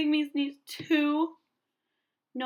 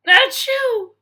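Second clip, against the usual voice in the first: loud, high achoo; acted sneeze; 11 semitones higher, 15 dB louder than usual. Gerald sneezing near Piggy, loud. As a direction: fire off a big sneeze.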